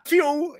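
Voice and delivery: high whistled whoosh of a fall; whoosh sound. Retold or imitated. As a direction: whistle the flight out the window. Narrating a throw out window.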